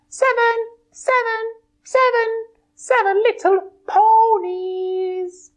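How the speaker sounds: high bright pony voice; a cheerful high puppet pony. Acted. Twilight puppet answering host, high pony voice.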